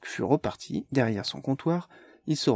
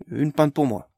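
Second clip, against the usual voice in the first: louder, different voice for Barnes; bold order; 3 semitones higher, 6 dB louder than usual. Acted. Barnes ordering a pint, louder. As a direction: order it boldly.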